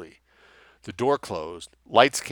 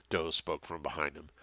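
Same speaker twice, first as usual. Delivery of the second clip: usual speaking voice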